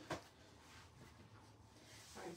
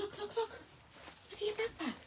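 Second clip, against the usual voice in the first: high sing-song; 11 semitones higher, 8 dB quieter, faster than usual. Real, not acted. Excited showing toddler her backpack.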